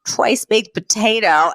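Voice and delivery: milk the sorrow while giving in; mock tearful. Upset Fran relenting about supper, mock tearful. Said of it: "sinks into Mister Sheffield's arms crying".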